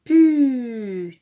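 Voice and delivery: loud, stretched "Pu" sliding downward; drawn-out syllable. Shown as a demonstration. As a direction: hold "Pu" on a long falling glide. Model answer in syllable game.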